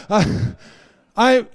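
ordinary delivery

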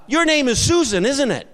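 retold bold mother, high and loud; bold confrontation. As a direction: call it out confidently to a stranger. Bold mother accosts a stranger.

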